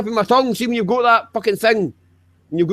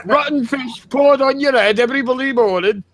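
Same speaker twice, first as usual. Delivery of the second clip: hammed-up Yorkshire rant; 3 semitones higher than usual. Hammed-up northern poverty rant.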